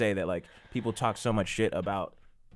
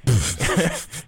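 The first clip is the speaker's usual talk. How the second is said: smug little laugh at her own joke; mock self-pleased laugh; 3 dB louder than usual. Mocking the comic laughing at her joke. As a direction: laugh like a comic waiting for applause. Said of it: "Hold for laugh".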